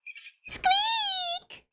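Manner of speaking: high crying squeak; dying squeak. Acted. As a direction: squeal as the mouse dies. Mouse being eaten.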